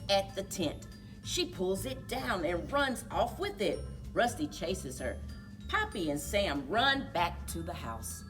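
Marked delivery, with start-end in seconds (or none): none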